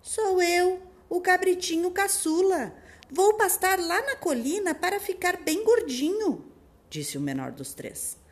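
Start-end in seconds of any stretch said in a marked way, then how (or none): 0.0-6.4 high squeaky little goat; tiny thin voice. Acted. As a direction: squeak it like the smallest kid. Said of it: "em uma voz bem fininha". (voz bem fininha)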